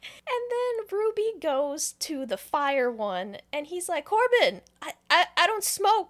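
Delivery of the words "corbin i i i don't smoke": high, loud, stammering panic; acted panic. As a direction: cry out for help, hammed-up and flustered. Retold Ruby panicking at the fire stone.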